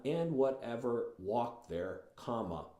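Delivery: slow, drawn-out "and whatever walked there"; read slowly. Shown as a demonstration. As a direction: stretch the phrase out. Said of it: "forces you to read that slowly".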